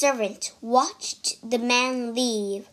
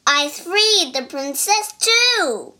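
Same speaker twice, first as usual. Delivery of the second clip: gleeful monkey; 5 semitones higher, 5 dB louder than usual. Acted. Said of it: "The monkey smiled".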